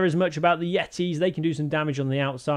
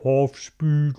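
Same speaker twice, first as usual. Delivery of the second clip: slow motion voice; much slower than usual. Pretend. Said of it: "that's me doing a s-, slow motion voice".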